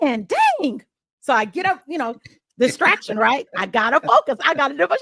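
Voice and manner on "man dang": high, annoyed grumble; retold exasperated outburst, pitched up. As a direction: complain out loud at being pulled away. Gets up annoyed at interruption.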